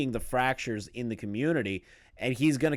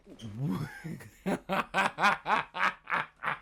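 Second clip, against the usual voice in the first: disbelieving laugh; 3 semitones higher, 4 dB quieter than usual. Real, not acted. Laughing at the clip.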